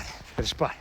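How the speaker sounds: soft, hushed whisper. Acted. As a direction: whisper so the prey won't hear. Repeated softly.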